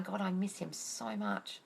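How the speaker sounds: quiet, slow wistful line; soft pining quote. Retold or imitated. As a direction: sigh it to yourself. Voicing missing partner.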